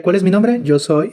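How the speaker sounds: normal talking voice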